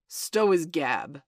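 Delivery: loud, slow angry roar. Acted. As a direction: bark it out slowly, loud. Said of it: "roared Hook".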